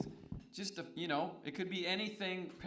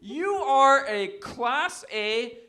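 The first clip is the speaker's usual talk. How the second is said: slow, loud, high-pitched insult; drawn-out emphatic put-down, each word hammered; mock insult; 6 semitones higher, 9 dB louder, much slower than usual. Real, not acted. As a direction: announce the insult slowly at full volume.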